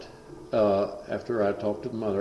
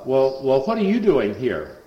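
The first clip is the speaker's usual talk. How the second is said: higher, louder sneering challenge; mock hostile greeting; 4 semitones higher, 7 dB louder than usual. Possessed son to the preacher, higher and louder sneer.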